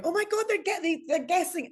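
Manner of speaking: high excited squeal; excited gasp. Retold or imitated. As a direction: squeal with delight at the guesses. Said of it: "howling and going".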